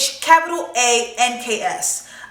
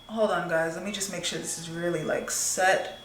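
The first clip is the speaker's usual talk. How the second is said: quiet aside; 4 semitones lower, 10 dB quieter than usual. Real, not acted. Aside while working on face.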